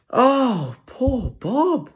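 high, loud cry of sympathy; crying out in pity. A narrator's reading. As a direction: wail for poor Bob. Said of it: "cried everyone".

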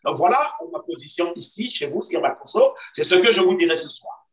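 ordinary delivery

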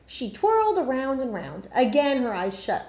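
plain reading voice

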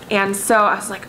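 ordinary talk, nothing marked

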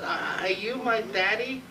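high, slow newborn voice; baby voice. Acted. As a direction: ask it like a tiny baby. Newborn baby asks the doctor.

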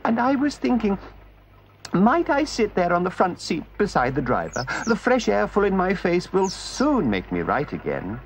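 high, frail put-on voice; feeble washerwoman. Toad disguised asks gentlemen for front seat, frail high voice.

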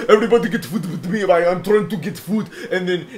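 put-on high Egyptian-accented protest. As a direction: whine back as an aggrieved Egyptian customer. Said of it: "just a general Egyptian accent".

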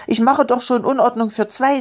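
put-on high, pleading child's protest; desperate. A narrator's reading. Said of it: "sagte Elli verzweifelt". (leicht verzweifelt und höher)

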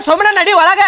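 firm order. Acted. Husband orders his sulking wife to go inside, firmly. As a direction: push her inside, voice rising.

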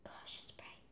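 soft breathy whisper to herself; hushed surprise at the glare. Real, not acted. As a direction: whisper it under your breath. Whispered reaction to the light.

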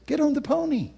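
high-pitched imitated woman; woman's coaxing command. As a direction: lift the pitch, unshouted, to coax a child. Woman with pony invites the child.

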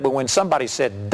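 ordinary delivery

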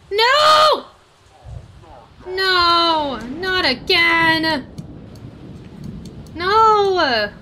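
high, loud, drawn-out wail; anguished cry. Real, not acted. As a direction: wail no in dismay. Another animal dies in game.

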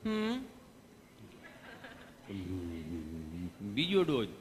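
slow, low, halting hum before the words; stammering patient. Retold or imitated. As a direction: stall with a hum, then mumble the answer. Gabho voiced, stammering reply.

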